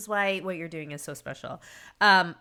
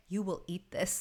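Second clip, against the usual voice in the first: a hint of a stern mother, much softer; stern mom; 8 dB quieter than usual. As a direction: quietly lay down the law to the kids. Mom voiced to her kids.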